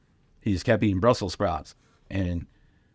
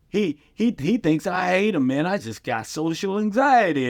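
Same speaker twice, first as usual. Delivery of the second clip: imitating Charlie; 10 semitones higher than usual. Charlie onstage, high voice.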